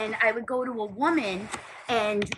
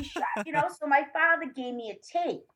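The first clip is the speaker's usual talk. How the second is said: ordinary talk, nothing marked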